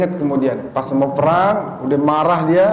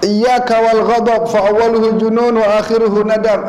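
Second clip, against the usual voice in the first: higher, louder Arabic quotation; Arabic recitation; 7 semitones higher, 4 dB louder than usual. Acted. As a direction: declaim the Arabic proverb. Quotes Arabic saying.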